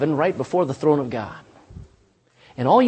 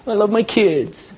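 mock devotion. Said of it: "You say".

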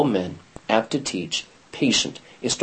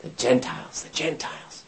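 whispered echo; 10 dB quieter than usual. Retold or imitated. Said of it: "prophecies saying".

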